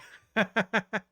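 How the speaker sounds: big burst of real laughter, high and loud. Real, not acted. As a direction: laugh out loud at the obvious twist. Laughing at the isekai reveal.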